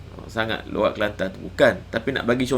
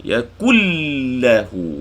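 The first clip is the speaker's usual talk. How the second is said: Quran recitation; 5 dB louder, much slower than usual. Shown as a demonstration. Teacher reciting a verse for students to follow, Quran chant. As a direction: recite yashfa' stretching the shin. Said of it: "Tak ada dengung sini".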